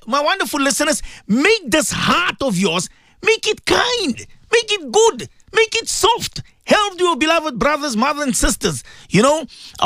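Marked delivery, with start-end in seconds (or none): 1.3-6.4 slow start, then each make it line raised high; fervent plea. Real, not acted. Preacher pleading with listeners to soften hearts, raised and high. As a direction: lift each plea higher.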